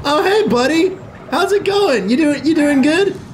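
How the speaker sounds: high pet-talk voice; friendly coaxing. Pretend. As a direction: greet a nervous pet, high and sweet.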